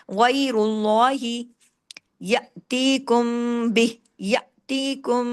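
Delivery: गैरुल्लाही with heavy गै and रा, slow; drawn tajweed reading; slow recitation. Shown as a demonstration. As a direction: recite with a full heavy गै and लाम. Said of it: "रा को heavy भी पढ़ना है".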